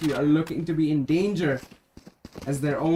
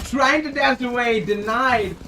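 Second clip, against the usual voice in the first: excited call; 7 semitones higher, 6 dB louder, slower than usual. Real, not acted. Caster calls a denied escape.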